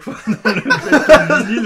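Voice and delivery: loud, high teasing through laughter; laughing. Real, not acted. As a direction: crack up while making the point. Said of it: "je rigole".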